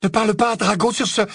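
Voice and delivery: high, quick, indignant objection; furious protest. Acted. Narcissa defends Drago against Bellatrix, furious. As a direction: snap back fast in defence. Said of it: "protesta Narcissa furieuse".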